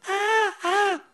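very high, loud seabird wail; imitated bird cry. Shown as a demonstration. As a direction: wail shrilly like a bird, sounding like a crying baby. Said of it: "high-pitched voice".

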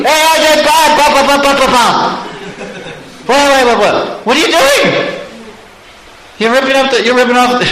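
high-pitched, outraged sputtering at a thief; alarmed gardener. Acted. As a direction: yelp in a high, panicky gardener's voice. Gardener catches the tree thief.

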